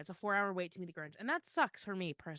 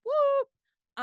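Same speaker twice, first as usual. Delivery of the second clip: cheering; 10 dB louder than usual. Real, not acted. Cheering own news of moving.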